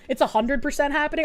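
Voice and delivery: ordinary talk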